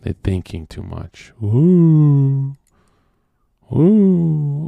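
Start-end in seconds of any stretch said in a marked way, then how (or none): 1.4-4.7 mocking ooh. Mocking overthinkers.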